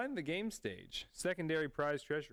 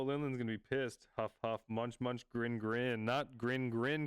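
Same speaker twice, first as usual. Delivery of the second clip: usual speaking voice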